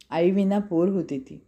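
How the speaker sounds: ordinary delivery, nothing marked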